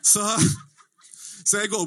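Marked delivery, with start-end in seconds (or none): none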